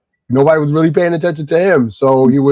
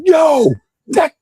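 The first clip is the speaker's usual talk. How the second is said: the street side of him bursting out; angry shout. Retold or imitated. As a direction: snap at him with a sudden shout. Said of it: "That side of Corey came out".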